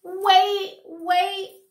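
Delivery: loud, high desperate plea; pleading call. A narrator's reading. Fox pleading with Flossie to stop, loud and high. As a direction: call after her begging.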